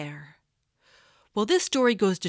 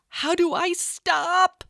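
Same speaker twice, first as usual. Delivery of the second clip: high, loud alarmed question; stuck in the air, scared; panicked cry; 10 semitones higher, 4 dB louder, slower than usual. Acted. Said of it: "screamed Lottie".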